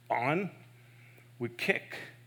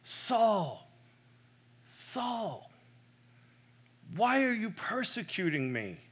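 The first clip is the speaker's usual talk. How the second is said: voice of Jesus; 7 semitones higher, slower than usual. Acted. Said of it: "he heard a voice speaking to him".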